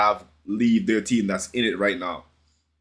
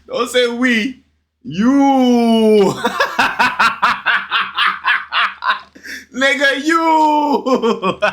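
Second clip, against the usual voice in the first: high, loud laughing yell of you; cracking up while calling him out; real laughter over the words; laughing shout; 10 semitones higher, 4 dB louder, much slower than usual. Real, not acted. Reactor laughs at fan's we.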